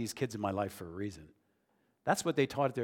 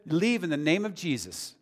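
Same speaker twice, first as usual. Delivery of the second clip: commanding prayer; 6 semitones higher, 6 dB louder than usual. Retold or imitated. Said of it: "I said".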